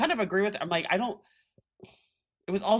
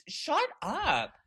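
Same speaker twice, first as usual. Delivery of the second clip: high, slow drawn-out "shut up"; hammed-up disbelief; mock shut up; 14 semitones higher, much slower than usual. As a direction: stretch it out in a high mocking squeal. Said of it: "And I'm like".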